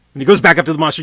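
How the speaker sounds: rising into the outburst; building up. A narrator's reading.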